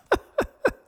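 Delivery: short bright laugh, voice jumping up; real laugh. Real, not acted. Host laughing while plugging the snack.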